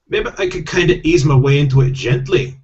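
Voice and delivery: hammed-up Scottish voice, reluctant; Scottish accent. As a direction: protest in a put-on Scottish accent. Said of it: "I do have to do Scottish accents".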